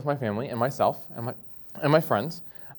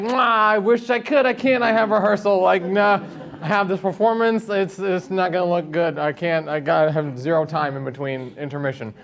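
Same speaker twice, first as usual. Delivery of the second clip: mock cheerful refusal; 7 semitones higher, 8 dB louder than usual. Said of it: "I'm like".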